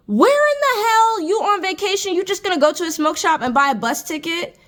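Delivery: high, incredulous rant; where in the hell, said sky-high in disbelief; outraged disbelief. Real, not acted. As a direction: voice jumps up in outrage. Outraged about smoke shop tickets.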